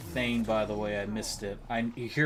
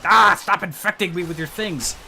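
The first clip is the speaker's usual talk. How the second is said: frustrated yell; 7 semitones higher, 7 dB louder than usual. Real, not acted. Frantic reaction to game enemies.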